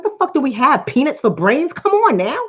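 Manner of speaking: fed-up disbelieving rant; exasperated. Retold or imitated. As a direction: scold the crowd in disbelief. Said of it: "I'm like".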